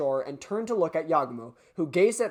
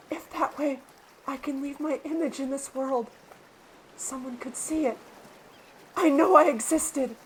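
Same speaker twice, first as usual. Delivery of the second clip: much higher and softer, wistful; Hitoa's quiet voice; 7 semitones higher, 4 dB quieter than usual. Acted. Hitoa explaining why she films: high, soft, wistful voice. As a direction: speak barely above a breath of longing. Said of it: "I can't do her voice anymore".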